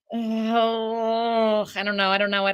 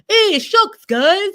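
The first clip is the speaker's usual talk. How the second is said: mock hokey Buffalo accent; 10 semitones higher, 8 dB louder, much slower than usual. Mocking the movie's fake Buffalo accent, high and loud. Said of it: "That's the accent that's going to be in the movie".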